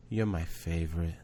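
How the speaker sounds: low, soft, hushed sweetness; tender niece's confession. Retold or imitated. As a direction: softly imitate a child sharing a secret.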